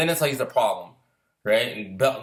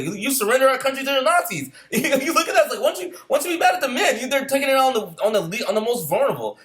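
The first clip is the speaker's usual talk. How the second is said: pitched-up outraged rant, breaking into a laugh; heated tirade; 9 semitones higher than usual. Pretend. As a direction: lay into them like they're in front of you. Ranting at the French men.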